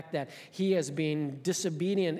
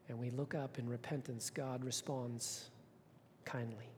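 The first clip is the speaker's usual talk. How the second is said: gentle assurance; 4 semitones lower, 12 dB quieter than usual. Acted. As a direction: let grace land quietly. Tender closing of a point.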